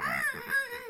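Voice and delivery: very high, sustained comic scream; mock victim's wail. Sacrifice victim wails as worker talks.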